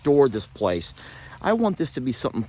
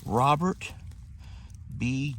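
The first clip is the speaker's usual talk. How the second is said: ordinary delivery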